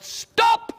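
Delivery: high yell of Stop!; sudden high cry of Stop!. Acted. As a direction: shout it out to the dragon. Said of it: "Stan stood there and yelled".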